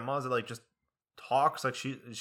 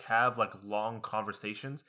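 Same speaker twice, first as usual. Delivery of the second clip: ordinary delivery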